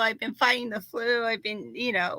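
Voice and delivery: normal talking voice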